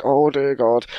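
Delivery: high loud gasp of dread; aghast sister. Retold or imitated. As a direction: relish the disaster.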